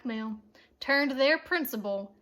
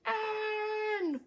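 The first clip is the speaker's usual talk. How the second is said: crowd answering cheer; 8 semitones higher, 5 dB quieter than usual. A narrator's reading. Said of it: "repeated the fans".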